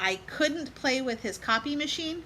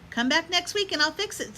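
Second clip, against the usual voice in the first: a higher, lightly voiced dentist; mildly voiced friendly dentist; doctor's voice; 8 semitones higher, 3 dB louder than usual. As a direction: lift the voice a touch for the doctor. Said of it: "said Doctor Fields".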